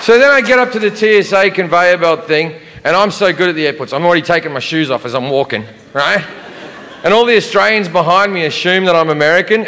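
normal talking voice

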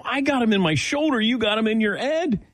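high, hurried mock protest; incredulous protest. Patient retelling his protest to the man who got shots, high-pitched and incredulous. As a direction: argue it in a high-pitched retold outcry.

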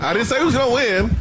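laughing defensive protest. Real, not acted. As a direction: insist through a laugh. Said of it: "repeats with laugh".

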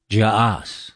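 "jas" drawn out on a long ah; Irish pronunciation. Shown as a demonstration. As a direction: say "jas" like jahhs, ending in s. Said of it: "in Irish".

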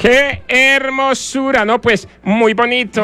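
lady admiring, high and slow. Retold or imitated. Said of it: "le dijo".

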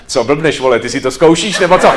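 loud, baffled question, rising at the end; nechápavý dotaz. Acted. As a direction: ask a colleague in disbelief. Kolega za chodu osloví herce. (hlasitě a nechápavě se zeptat)

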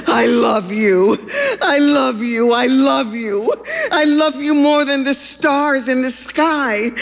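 rising tearful confession. Acted. Duck declares love, building.

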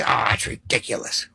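low, breath-only mock dismissal; dismissive scoff. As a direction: mimic doubters under your breath. Imitates dismissive people.

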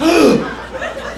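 high mock gasp of horror; shocked gasp. Mom gasps at news.

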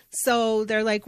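normal talking voice

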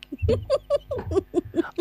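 high horror-movie doll giggle; evil doll giggle. Acted. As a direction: imitate an evil doll's creepy little laugh. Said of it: "evil dolls in it".

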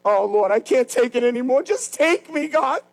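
high, pleading mock complaint; exasperated mock plea to God; whiny weary prayer. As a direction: whine it up high like a worn-out believer. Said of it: "Paul said, you ever do these things".

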